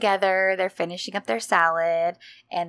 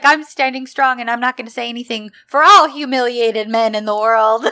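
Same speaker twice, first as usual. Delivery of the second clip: mock heroic declaration; 3 semitones higher, 7 dB louder than usual. Erdem defending humiliated men, louder mock-heroic vow. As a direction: declare it like a hammed-up hero. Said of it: "he's like".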